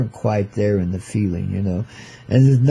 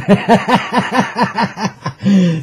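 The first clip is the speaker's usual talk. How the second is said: a long, high chuckling laugh; hearty laugh; 6 semitones higher than usual. Real, not acted. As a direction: laugh at your own joke. Host laughs after his riff.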